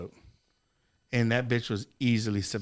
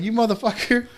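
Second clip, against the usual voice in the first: high, louder mock yell at his brother; mock angry yell; 10 semitones higher, 6 dB louder than usual. Yelling at his brother in a mock rage. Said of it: "I was like".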